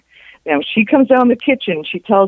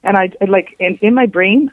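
ordinary talk, nothing marked

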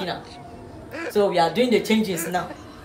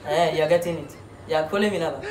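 usual speaking voice

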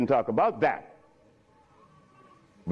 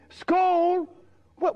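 usual speaking voice

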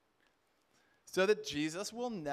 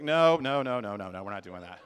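loud burst of refusal, settling lower; firm refusal; 7 semitones lower, 9 dB louder than usual. Retold or imitated. Friend talking back to the enemy, firm refusal. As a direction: burst out loudly, then drop into finality. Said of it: "that's him talking to the enemy".

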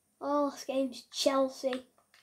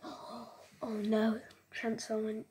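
ordinary talk, nothing marked

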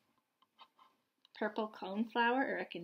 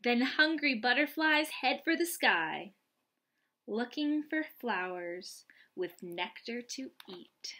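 bright, louder read-aloud storytelling voice; picture book reading; 3 semitones higher, 5 dB louder than usual. Acted. Reading a butterfly picture book to young children, lively and bright.

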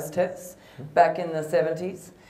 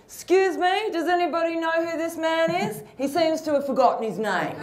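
much higher, sweetly sarcastic announcement; a hostess calling out to the cabin, mock-sweet; air hostess announcement; 10 semitones higher than usual. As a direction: address the whole cabin with mock politeness. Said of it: "she turned around to the whole plane".

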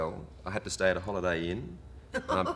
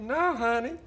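put-on twangy Southern accent. Motel clerk correcting a lost tourist, high twangy Southern accent. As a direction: mimic a twangy Southern woman putting someone right. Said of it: "her accent was so weird and twangy".

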